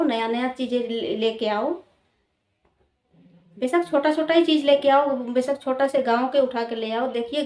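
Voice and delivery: ordinary talk, nothing marked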